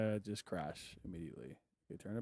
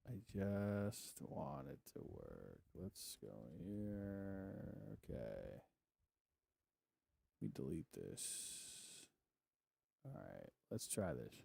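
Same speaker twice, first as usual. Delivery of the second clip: much lower and softer, slow and deflated; defeated muttering; 4 semitones lower, 14 dB quieter, slower than usual. Real, not acted. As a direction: mumble to yourself, worn out. Defeated muttering while fiddling.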